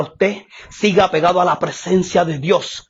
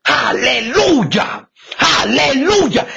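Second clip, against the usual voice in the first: shouted high preacher cadence with tearful aleluyas; fiery preaching; 9 semitones higher than usual. Acted. Preacher crying out to the congregation, fervent shout. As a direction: preach it out with full fervour.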